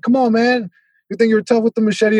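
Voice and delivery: high-pitched taunt, egging him on; imitated defiance. Boxer challenging the dad with the machete, imitated defiance. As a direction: mimic a fighter who won't back down. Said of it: "The guy was not backing down".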